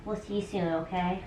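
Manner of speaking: quiet, gentle coaxing; soft coaxing. Real, not acted. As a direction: coax the cat in softly. Coaxing cat into carrier.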